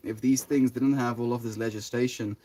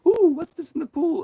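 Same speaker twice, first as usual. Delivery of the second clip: very high, loud mock wonder; hammed-up, squeaky-high intrigue; wide-eyed curiosity; 7 dB louder than usual. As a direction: coo with exaggerated excitement at a discovery. Acting out curious excited onlookers.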